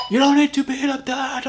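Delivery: high, called-out shouting voice; mock shout. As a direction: yell it like you're up the cliff. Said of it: "talk that way".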